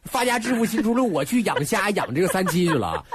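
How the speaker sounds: high-pitched, playful declaration of a new career; mock excitement. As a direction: announce the get-rich plan with mock zeal. Jokes about quitting to farm. (假装兴奋地高声宣布)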